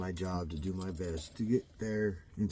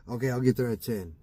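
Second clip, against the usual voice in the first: ordinary talk, nothing marked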